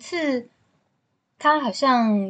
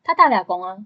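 ordinary talk, nothing marked

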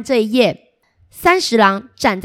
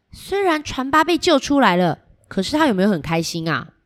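plain reading voice

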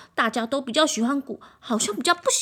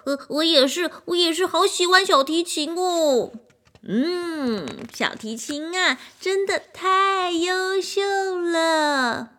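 higher, swooning praise; gushing admirers; 8 semitones higher, slower than usual. A narrator's reading. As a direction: gush over the violin. More admirers praising violin.

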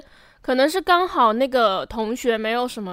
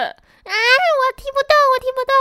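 put-on feeble girly whine; 14 semitones higher, 3 dB louder than usual. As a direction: whimper it like you can't kick at all. Mocking friend as too weak.

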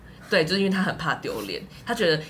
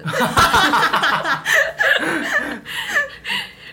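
burst of laughter; 8 dB louder than usual. Real, not acted. Bursting laugh at own story.

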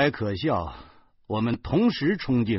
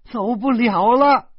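high, loud, drawn-out outburst; loud cry of exasperation; angry shout; 13 semitones higher, 11 dB louder than usual. A narrator's reading. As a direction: shout it slowly, pitched way up. Wife's angry outburst in quarrel.

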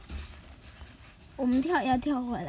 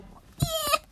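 much higher little whoop; gleeful cheer. Acted. Nobita cheering his escape, much higher. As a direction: cheer like you just got away.

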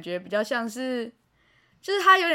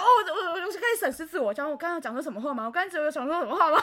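flustered; 2 semitones higher, faster than usual. Real, not acted.

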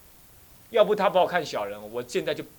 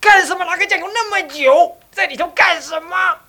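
angry shout; 10 dB louder than usual. Retold or imitated. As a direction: bellow at him for taking so long with the soy sauce. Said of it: "唱功在那边骂了".

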